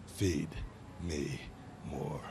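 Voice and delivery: a soft, dark, drawn-out threat; low menacing growl. Acted. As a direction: drop low and growl each word slowly. Catchphrase to close the promo.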